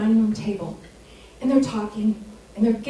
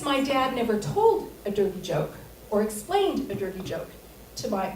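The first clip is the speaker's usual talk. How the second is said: usual speaking voice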